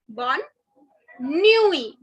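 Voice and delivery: bonne nuit with the final T silent, "nwee"; French bonne nuit. Shown as a demonstration. Teacher modelling bonne nuit for the class, clear and slow. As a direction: say bonne nuit slowly, dropping the last T. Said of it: "the last T is not to be pronounced".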